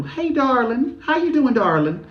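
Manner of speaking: very high Southern sing-out; high-pitched Southern impression. Shown as a demonstration. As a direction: greet them in a playful Southern accent. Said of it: "a little Southern darling, too".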